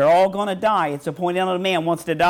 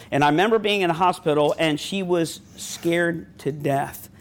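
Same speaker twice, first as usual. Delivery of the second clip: lower, subdued storytelling; subdued, somber; 6 semitones lower than usual. Real, not acted. Recalling his sister's terminal cancer to the congregation, subdued and low. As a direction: drop low and recall it gently.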